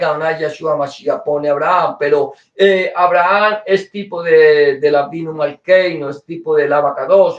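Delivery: normal talking voice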